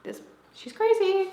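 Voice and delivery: very high, put-on squeal; high-pitched mock verdict; mock judging viewers. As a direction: imitate onlookers in a high silly voice. Imagined viewers judging her.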